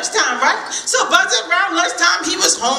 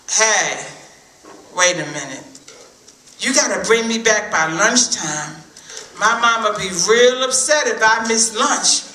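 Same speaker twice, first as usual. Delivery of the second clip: much lower and grave; lower-voiced squirrel aside; 6 semitones lower than usual. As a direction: drop the voice for a serious condition. Squirrel's worried condition.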